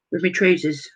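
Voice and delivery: usual speaking voice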